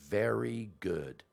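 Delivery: loud, very slow, drawn-out praise; stretched-out imitation of a teacher's approval; retold teacher's verdict. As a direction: pronounce it grandly like an imposing teacher. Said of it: "She said".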